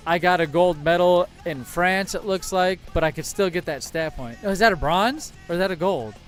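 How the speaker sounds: ordinary talk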